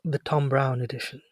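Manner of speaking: hushed, affectedly posh announcement; put-on posh accent. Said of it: "I've gotta use my other voice".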